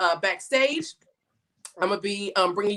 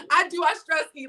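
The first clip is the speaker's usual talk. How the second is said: laughing; 6 semitones higher than usual. Real, not acted. Laughing at her stress eating.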